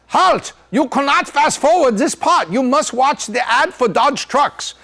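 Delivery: put-on German accent. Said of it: "with a German accent".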